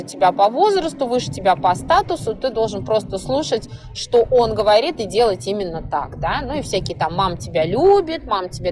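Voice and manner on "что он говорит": ordinary talk, nothing marked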